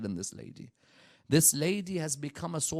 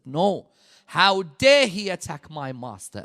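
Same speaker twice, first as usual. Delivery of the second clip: angry refusal; 8 dB louder than usual. Acted. Fidda refuses Imam al-Hasan.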